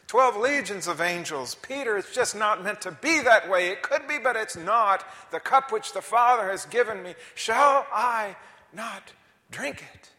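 preacher's thundering climax; preaching at full force. Acted. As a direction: hammer each word home.

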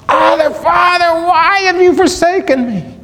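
very high, shrieked cry from the soul; anguished cry. Retold or imitated. Preacher voices Jesus on the cross, anguished shriek. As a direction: cry out in agony to a father. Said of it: "used for Jesus on the cross".

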